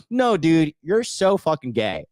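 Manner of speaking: ordinary delivery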